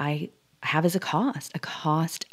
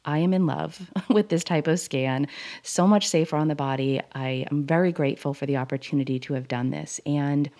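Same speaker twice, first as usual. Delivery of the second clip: ordinary talk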